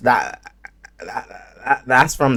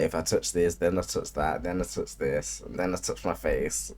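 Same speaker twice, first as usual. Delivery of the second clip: hushed inner voice, listing each touch; quiet overthinking; 6 semitones lower, 11 dB quieter than usual. Retold or imitated. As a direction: mutter the worry to yourself, much softer. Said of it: "You like".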